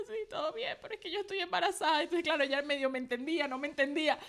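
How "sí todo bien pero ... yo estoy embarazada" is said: retold crying reply. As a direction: answer through tears. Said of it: "yo llorando". (respuesta llorosa, aguda y suave)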